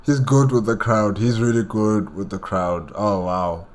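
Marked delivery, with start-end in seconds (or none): none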